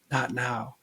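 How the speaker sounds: a soft, hushed "Not now." with the voice dropped; subtle inner voice. Retold or imitated. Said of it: "this subtle voice came in".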